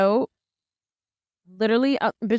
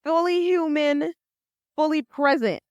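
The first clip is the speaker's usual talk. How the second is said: panicked report; 7 semitones higher than usual. Retold or imitated. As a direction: spell out the shock word by word. Ben panicking about the hologram.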